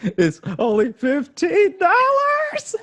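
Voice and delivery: squeaking the words out through laughter, slowly; high laughing squeal. Real, not acted. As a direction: let the laugh pitch it way up. Cracking up about the bill.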